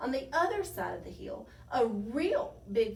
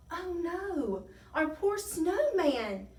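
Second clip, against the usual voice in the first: high, drawn-out dismayed wail; distressed cry; 8 semitones higher, 5 dB louder, slower than usual. A narrator's reading. Tiny watching the snowman near the ravine, dismayed. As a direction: lament it, high and slow. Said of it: "cried Tiny Ranosaurus".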